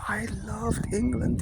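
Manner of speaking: put-on high, slow, fond old-man voice; old German man. Old man telling the lads of England, slow fond high voice.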